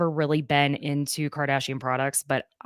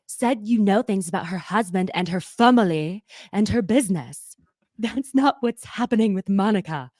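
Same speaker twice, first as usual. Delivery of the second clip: imitating Lisa; 2 semitones higher than usual. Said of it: "'cause Lisa goes".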